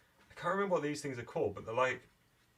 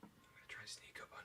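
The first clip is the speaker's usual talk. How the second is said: whispering; 18 dB quieter, much faster than usual. Acted. Sneaking in game.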